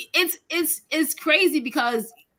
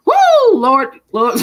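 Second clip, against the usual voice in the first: excited exclamation; 6 dB louder than usual. Real, not acted. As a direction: holler it out. Exclaiming over great grandmother.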